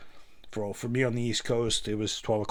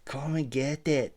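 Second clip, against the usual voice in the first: put-on Scottish accent; 5 semitones higher, slower than usual. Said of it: "the Scottish".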